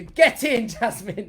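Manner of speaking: high, loud burst of delight; excited cheer. Real, not acted. Coach cheers as a viewer's comment comes in.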